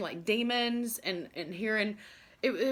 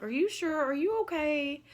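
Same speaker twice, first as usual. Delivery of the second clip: put-on higher, worried boyfriend's questions; concerned boyfriend; 8 semitones higher than usual. Boyfriend asks his scared girlfriend in Walmart, concerned. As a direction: imitate him checking on her gently.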